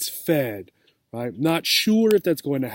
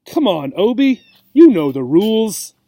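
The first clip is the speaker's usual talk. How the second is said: higher, louder impatient urging; 5 semitones higher, 7 dB louder than usual. Acted. The cat impatiently pushing Obie to keep playing. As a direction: hurry her along, fed up. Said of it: "The cat let out an impatient sigh".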